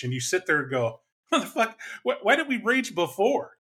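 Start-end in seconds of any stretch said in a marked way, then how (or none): 1.3-3.5 high-pitched, stammering bewilderment; exasperated question, pitched way up; mock baffled player. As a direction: play someone confused by their own rage. Acting a baffled raging player.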